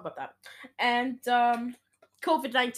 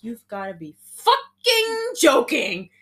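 exasperated disbelief; 5 semitones higher, 7 dB louder than usual. Retold or imitated. Maya reacting in story.

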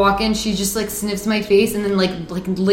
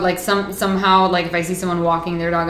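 ordinary talk

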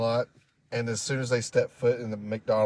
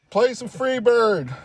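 heckling shout; 11 semitones higher, 5 dB louder, slower than usual. Pretend. Mocking audience heckle.

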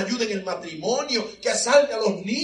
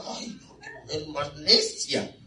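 annoyed disciples; 3 semitones lower than usual. Retold or imitated. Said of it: "algunos ya están".

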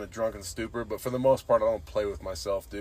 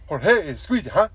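high, loud, pestering coworker with mock Mexican accent; hammed-up eager coworker impression; imitated Mexican coworker; 9 semitones higher, 6 dB louder than usual. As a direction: pester with quick rising questions, pitched way up. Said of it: "So it'd be like, uh".

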